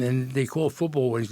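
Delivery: ordinary talk, nothing marked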